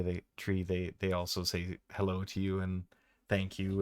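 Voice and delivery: ordinary talk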